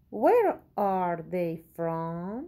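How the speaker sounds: very slow, clear question for learners; slow model reading. Shown as a demonstration. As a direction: read the question out word by word. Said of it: "teacher reads question slowly". (سؤال را خیلی آهسته و شمرده بخوان)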